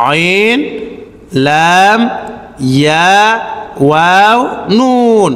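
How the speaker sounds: each letter stretched, as in "আইন"; drawn-out letter names. Shown as a demonstration. Teacher lists letters for learners, each like "ওয়াও" drawn out. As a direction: say "নুন" long and separate.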